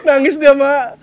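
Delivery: words burst out high and loud through laughter; laughing loudly. Real, not acted. Husband telling his mother the wife cried, loud laughing. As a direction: laugh while telling her.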